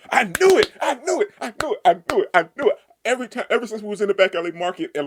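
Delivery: high, loud, repeated triumphant yells; excited shouting. Real, not acted. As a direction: crow that you called it all along. Reader thrilled by the kiss.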